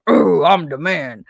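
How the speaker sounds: loud, slow mock-macho boast; mock swagger. Teacher mimics the principal strutting past the staff, mock swagger. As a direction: puff it up like a cocky boss.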